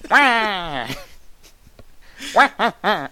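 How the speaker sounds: high, loud, nerdy villain wail; hammed-up high "wah" impression; Waluigi. Shown as a demonstration. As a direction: honk it out like a kinder, nerdier cartoon villain. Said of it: "nerdier Waluigi".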